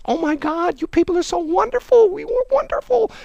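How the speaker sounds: very high, shrill-pitched gushing; mock gushing squeal. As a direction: squeal with fake delight. Mocking Democrats in D C.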